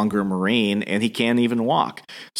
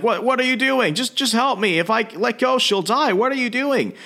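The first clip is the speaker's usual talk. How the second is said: panicked pleading; 14 semitones higher than usual. Retold or imitated. Trapped man begs alien suit for help, panicked pleading. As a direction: act out a desperate man begging for help.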